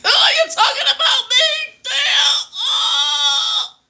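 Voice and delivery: high, loud squealing cry of being caught out; a hammed-up shocked wail, far above her own voice; mock guilty shriek. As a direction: shriek it like someone whose guilt was just exposed. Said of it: "your conscience is making you feel like".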